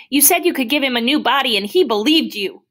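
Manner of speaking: fast, high, loud outburst; upset accusation. Acted. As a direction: hurl the blame at the doctor in a rush. Boy accuses doctor of broken promise.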